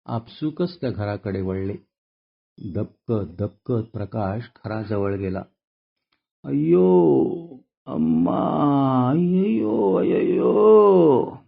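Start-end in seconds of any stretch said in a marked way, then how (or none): 6.4-11.4 drawn-out anguished groans, high and loud; moaning in pain. A narrator's reading. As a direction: wail each cry slowly like a hurt man. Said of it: "कोणाच्या तरी कण्ह्याचा आवाज".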